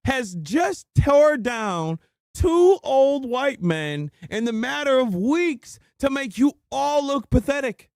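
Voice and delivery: high-pitched, drawn-out indignant rant; exasperated scolding. Real, not acted. Streamer berating a caller over a girl, exasperated. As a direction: scold with stretched, emphatic words in a high voice.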